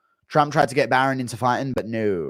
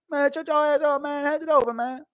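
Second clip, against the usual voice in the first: very high squeaky put-on kid. Kids talking back to a fighter, very high mock kid voice. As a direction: pipe up like a bold little kid. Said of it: "Chandler's kids meeting McGregor".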